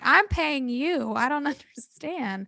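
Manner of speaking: high, slow, incredulous plea; exasperated. Retold or imitated. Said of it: "I'm like".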